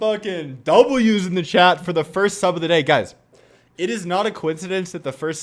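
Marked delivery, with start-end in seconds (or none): 0.0-2.8 high, loud rallying call to chat; hype yell. Real, not acted. Streamer rallies chat after first sub, loud hype yell. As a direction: pump the chat up.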